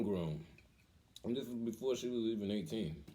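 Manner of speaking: ordinary talk, nothing marked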